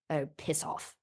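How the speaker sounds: low, quiet brush-off; muttered aside. Retold or imitated. O'Toole breaking character to a laughing woman, quiet low aside. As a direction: drop it low and under the breath.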